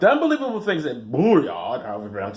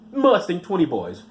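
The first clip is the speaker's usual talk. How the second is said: normal talking voice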